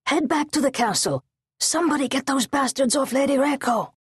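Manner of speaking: high, urgent captain's orders; shouted command. Acted. Guard captain rallying men to save the lady, high urgent shout. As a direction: keep the pitch up, driving the men on. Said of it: "her guard captain shouted".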